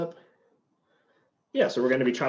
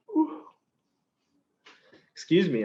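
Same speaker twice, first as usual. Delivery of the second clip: teary onion surprise; 8 semitones higher, 3 dB louder than usual. Real, not acted. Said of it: "I'm getting a little emotional".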